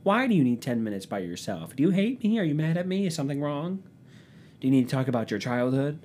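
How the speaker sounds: high, worried rapid questions from a partner; anxious partner. Retold or imitated. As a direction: pester him like an insecure girlfriend. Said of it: "And they go".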